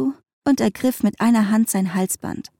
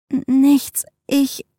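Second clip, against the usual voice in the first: stuttered, higher-pitched start that breaks off; stammering; 5 semitones higher, 4 dB louder, slower than usual. A narrator's reading. Emily answers Linda's question about what happened, stammering. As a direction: stumble on the first sound, then falter. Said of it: "stotterte Emily".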